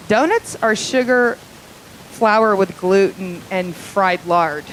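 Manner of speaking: usual speaking voice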